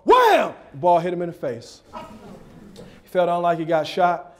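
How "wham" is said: explosive high "wham"; very loud vocal hit effect; shouted impact sound. Retold or imitated. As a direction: shout it like a sudden smack. Ball hits the boy.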